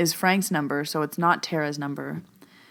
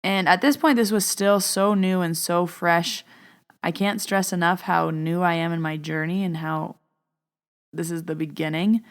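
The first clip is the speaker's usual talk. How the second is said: normal talking voice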